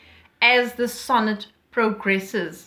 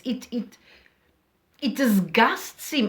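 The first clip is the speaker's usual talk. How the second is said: usual speaking voice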